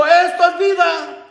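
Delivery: high, slow, drawn-out cry; mock-triumphant call; reenacted carefree shout. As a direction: imitate revellers raising a toast.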